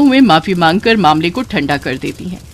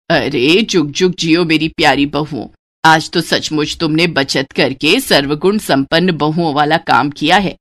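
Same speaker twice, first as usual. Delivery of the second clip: blessing mother-in-law; 5 semitones lower than usual. Acted. Mother-in-law blesses her thrifty bride, low and fond. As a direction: bless her like a proud elder.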